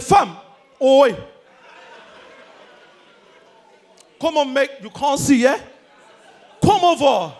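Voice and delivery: loud, pushy London slang; put-on London accent. Returnee calling a waiter over, loud London accent. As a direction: summon someone in a fake London accent. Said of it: "He goes to every restaurant like".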